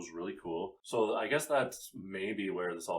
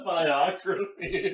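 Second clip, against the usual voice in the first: loud, high, drawn out through laughter; laughing; 12 semitones higher, 11 dB louder, much slower than usual. Real, not acted. Host repeats co-host's word in hysterics, laughing. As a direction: crack up while repeating the word. Cracking up over the pronunciation joke.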